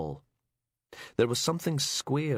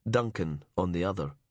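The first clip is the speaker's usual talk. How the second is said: ordinary delivery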